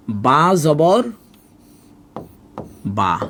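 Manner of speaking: "বা জবর বা" recited slowly, the vowel sounded as aa; zabar as a-kar. Shown as a demonstration. Teacher shows learners the zabar vowel, reciting "বা জবর, বা" with aa. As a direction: spell out "বা জবর", pause, then say "বা" with an open aa. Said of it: "জবরের উচ্চারণ আকারের মতো করে হবে".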